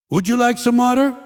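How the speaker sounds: high, lilting waiter voice; sing-song server offer; a hint of an overeager waiter; mock waiter.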